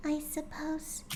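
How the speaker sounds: high, hushed whispering pony; soft, whispered confession; Fluttershy whisper. Acted. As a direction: confide a secret in a whisper.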